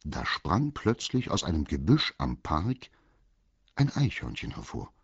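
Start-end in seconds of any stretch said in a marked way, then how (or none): none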